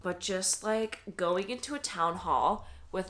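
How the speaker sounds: usual speaking voice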